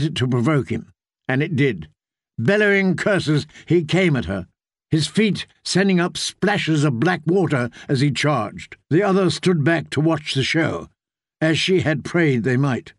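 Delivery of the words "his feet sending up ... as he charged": ordinary delivery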